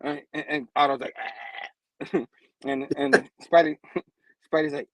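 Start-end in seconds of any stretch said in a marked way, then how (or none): 1.2-2.2 low, soft, strangled "ah"; acted choked groan; choking noise. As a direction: croak it as if being throttled. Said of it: "Otto getting choked".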